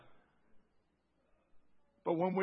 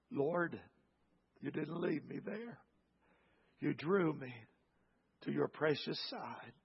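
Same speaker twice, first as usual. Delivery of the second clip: prayer. Acted. Closing prayer.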